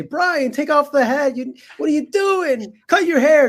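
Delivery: high nagging mom voice; imitating his mother. Son mimics his mother nagging him about his hair. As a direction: pitch way up like a scolding mother. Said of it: "not call me and say".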